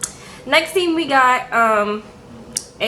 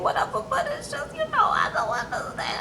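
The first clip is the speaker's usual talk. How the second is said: much higher, soft confused mother; quavering mom; 14 semitones higher, 7 dB quieter than usual. Retold or imitated. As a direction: let the voice climb in bewilderment.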